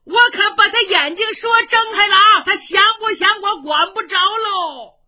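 gleeful. Acted. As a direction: brag to the room. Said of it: "程咬金这乐哟".

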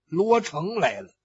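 plain reading voice

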